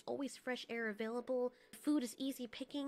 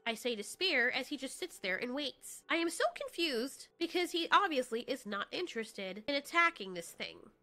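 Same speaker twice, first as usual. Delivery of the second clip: usual speaking voice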